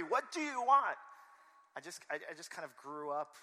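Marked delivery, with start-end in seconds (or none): 0.0-1.0 loud, high-pitched mock demand; hammed-up exasperated shout. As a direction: act out a wife at the end of her patience. Acts wife demanding an answer.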